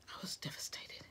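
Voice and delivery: mock-tragic whisper. Real, not acted. Vlogger telling viewers her order was canceled, in a whisper. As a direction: whisper it as a playful confession.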